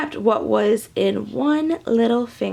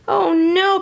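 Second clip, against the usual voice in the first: wailing; 6 semitones higher, 3 dB louder than usual. Acted. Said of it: "Patrick O'Michael wailed".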